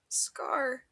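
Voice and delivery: unvoiced tearful whisper; barely a breath through tears; weeping whisper. A narrator's reading. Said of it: "Grian weeps".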